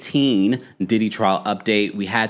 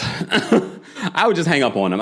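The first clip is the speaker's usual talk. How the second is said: laughing; 7 semitones higher, slower than usual. Real, not acted. Laughing exasperation.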